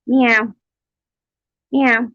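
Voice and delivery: high playful meowing; mock cat meows. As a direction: squeak out a cat's reply. Voicing cat's answers.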